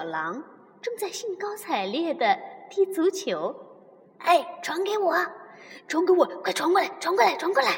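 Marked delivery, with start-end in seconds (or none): 4.2-7.7 excited shouts, higher and fast; calling for the ball. Acted. Young wolf shouting to teammates for the ball, excited. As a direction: yell for the pass, eager. Grey wolves shouting during football.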